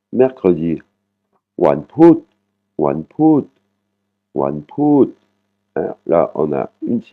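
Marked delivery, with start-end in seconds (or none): 1.6-5.1 Wanput with a short "oo" in the last syllable; short "ou" vowel. Shown as a demonstration. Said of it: "une voyelle courte, qui est le ou". (Wanput, « ou » court sur la dernière syllabe)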